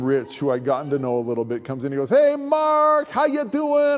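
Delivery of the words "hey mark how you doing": high, sing-out hello; hearty greeting. Retold or imitated. Rich coming in for lunch to Mark, exuberant greeting. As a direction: hail a pal with exaggerated cheer. Friend greeting him in the deli.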